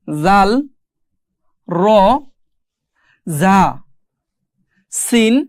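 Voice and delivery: Arabic letter names recited singly with long pauses; measured, isolated alphabet drill; letter-by-letter demonstration. Shown as a demonstration. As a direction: sound out each letter firmly for learners to repeat. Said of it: "আমি সবগুলো হরফ আপনাদের এখন পড়ায় দিব".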